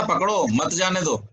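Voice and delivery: "पकड़ो" alone, then "मत जाने दो" run together; pause after पकड़ो. Acted. Acted listener twists the instruction, pausing after पकड़ो. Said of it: "आपने ही तो कहा था".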